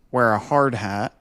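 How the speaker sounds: usual speaking voice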